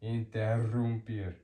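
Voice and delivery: "Interrumpir" with a firmly trilled rr; strong rolled RR. Shown as a demonstration. Teacher recapping two R's for learners, "Interrumpir" with a strong roll. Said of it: "Two is strong and rolled".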